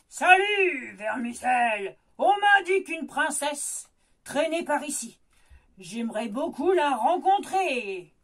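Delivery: loud, low booming ogre; hearty loud ogre laughing at the frog; gruff ogre. Acted. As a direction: greet the frog like a big bully. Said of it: "lance le petit gros".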